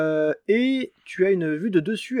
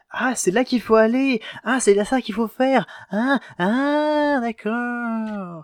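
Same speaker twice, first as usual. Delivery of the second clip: excited realization; 6 semitones higher, 4 dB louder, slower than usual. Retold or imitated. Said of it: "je suis en mode".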